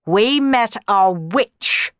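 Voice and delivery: slow, loud snarl; drawn-out, forceful accusation. A narrator's reading. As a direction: snarl it out word by word. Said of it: "the Baron snarled".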